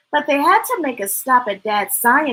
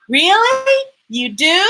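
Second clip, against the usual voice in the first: anxious; 7 semitones higher, 6 dB louder than usual. Acted. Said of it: "Lyndon said anxiously".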